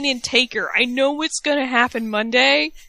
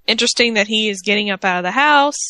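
usual speaking voice

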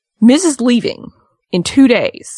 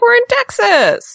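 mock wife voice; slower than usual. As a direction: squeak it out like a defensive wife. Imitating wife, same voice.

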